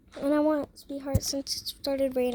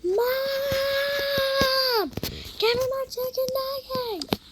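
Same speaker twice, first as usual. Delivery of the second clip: high, loud whiny yell; pitched-up demanding shout with a cry in it; yelling for mom; 11 semitones higher, 4 dB louder, much slower than usual. Acted. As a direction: holler across the house for the nuggets. Kid yelling for nuggets.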